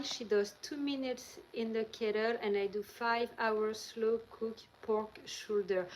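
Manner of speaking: normal talking voice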